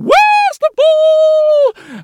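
very high, slow, loud shriek; a fussy governess shrieking in panic. Acted. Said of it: "the governess was shrieking".

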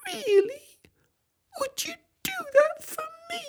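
very high, tearful and hopeful; teary hope. Acted. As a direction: ask fast through tears. Hopeful crying apple.

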